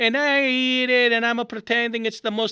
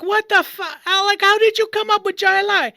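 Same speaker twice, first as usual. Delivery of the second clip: high, loud inner outburst; hammed-up disbelief at himself; incredulous self-reproach; 9 semitones higher, 5 dB louder than usual. Man amazed at his own lie to the doctor, loud disbelief.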